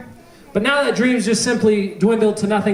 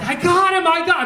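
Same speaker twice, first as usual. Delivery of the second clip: retold triumphant scream; 7 semitones higher than usual. Preacher re-enacting his cheer at downing the bat, mock scream. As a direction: imitate cheering in a raised, high pitch. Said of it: "I'm just screaming".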